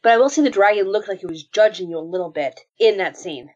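ordinary talk

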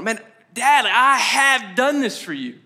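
slow, emphatic grievance; frustrated son. Acted. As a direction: act out an exasperated son, stretching the words. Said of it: "you felt frustrated".

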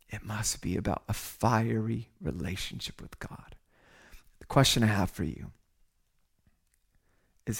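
low, soft, near-whispered sermon appeal; intimate, searching hush with long pauses; hushed, solemn. Real, not acted. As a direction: drop right down and let each question sink in. Solemn searching questions to congregation.

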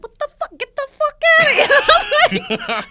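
very high, drawn-out indignant squeal; soprano outburst. Retold or imitated. Woman retells telling flirting stranger off, soprano-high outburst. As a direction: let the outrage climb into a soprano. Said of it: "I turned into a soprano".

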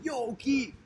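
high-pitched imitation of a hail; calling out. Buddy comes up after learning to get his attention, calling out high. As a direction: hail a pal, pitch jumping up. Another friend hails him likewise.

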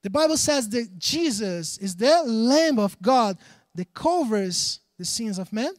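normal talking voice

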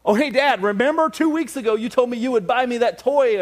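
put-on child reminding his dad about a promised toy; kid's voice. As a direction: pester like an excited kid. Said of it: "promise your kids something".

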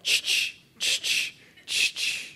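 soft unvoiced spritz sounds, three times; imitated perfume spray. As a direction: mimic spritzing perfume on yourself. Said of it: "she did a little spikenard".

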